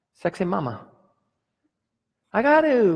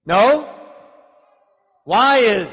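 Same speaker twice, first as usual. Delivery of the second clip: ordinary delivery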